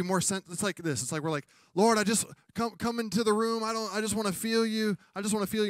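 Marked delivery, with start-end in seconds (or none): 1.7-5.7 pleading prayer. Retold or imitated. Preacher acts out pleading prayer.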